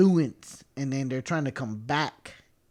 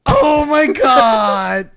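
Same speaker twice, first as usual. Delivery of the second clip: high, loud, very slow "oh my God"; excited shout; 9 semitones higher, 14 dB louder, much slower than usual. Retold or imitated. Father and son watching Vader appear, an awed slow shout. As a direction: shout it out stretched in awe. Still reliving the Vader scene.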